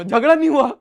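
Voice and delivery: breaks into loud laughter, high; laughing. Real, not acted. Husband laughs recounting how the fight stopped, laughing. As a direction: laughs through the punchline. Laughing at his own story.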